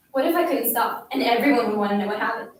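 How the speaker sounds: plain reading voice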